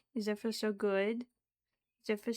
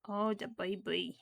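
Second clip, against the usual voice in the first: ordinary talk, nothing marked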